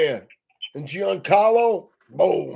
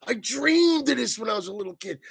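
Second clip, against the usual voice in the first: imitating Dodgers player; 6 semitones higher, 4 dB quieter than usual. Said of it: "the guy for the Dodgers said".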